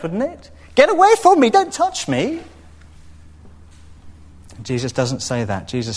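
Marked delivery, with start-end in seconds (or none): 0.7-2.4 very high and loud mock recoil; high squeal of offended purity; religious rulers recoiling. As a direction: shriek it like a leader shoving someone away. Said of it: "That's what the religious rulers would have said".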